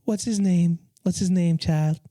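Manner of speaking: high, sassy radio host voice; Delilah impression. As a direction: play a gushing late-night radio host. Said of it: "they call and they're like".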